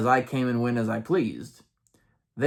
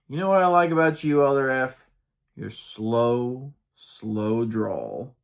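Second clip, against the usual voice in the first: slow drawl. Retold or imitated. Teasing Elder F about his drawl, drawling the ending. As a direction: rush the opening, then pause after each "slow". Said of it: "I paused after each slow".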